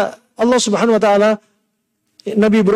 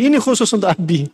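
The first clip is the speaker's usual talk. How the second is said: ordinary talk, nothing marked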